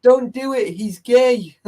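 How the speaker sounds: put-on high, loud kid's blurt; small boy objecting at a wedding; child's outburst. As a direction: shout it like a six-year-old. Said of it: "kid stood up and went".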